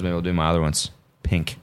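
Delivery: ordinary talk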